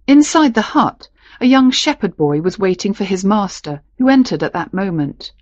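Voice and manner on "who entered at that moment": plain reading voice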